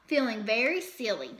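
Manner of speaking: ordinary delivery, nothing marked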